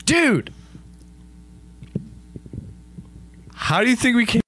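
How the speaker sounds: high-pitched, exasperated outburst; mock indignant protest. Adam confronting Darwin, mock indignant. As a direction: play it as an incredulous, high protest.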